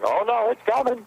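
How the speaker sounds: high, hammed-up worried announcement; mock fretful update. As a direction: squeal it like a nervous teaser post. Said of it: "constantly updating going".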